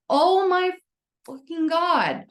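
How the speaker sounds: high, loud, drawn-out exclamation; retold astonished realization. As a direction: exclaim it as if it just dawned on you. Retelling her sudden big realization.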